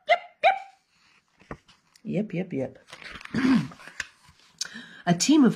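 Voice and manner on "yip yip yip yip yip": barking yips from outside; husky yips. A narrator's reading. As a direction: yip like a far-off husky. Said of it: "the animals heard yip".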